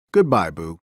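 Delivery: deep, gentle farewell in a big monster voice; low tender goodbye. Acted. Sully tucking Boo into bed, low and tender goodbye.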